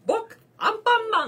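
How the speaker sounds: high and loud cartoon hero voice; Anpanman impression. 友達に似てると言われるアンパンマンの声、高く大声で. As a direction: squeak it like a kids' hero. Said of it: "似てる".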